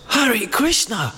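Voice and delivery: high, slow, delighted call of Hare Krishna; exuberant greeting. Retold or imitated. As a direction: retell the shop lady's joyful greeting. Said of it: "she was really exuberant".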